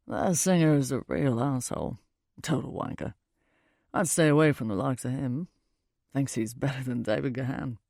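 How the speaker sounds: low, disparaging British stranger; British accent. A narrator's reading. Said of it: "He has this British accent".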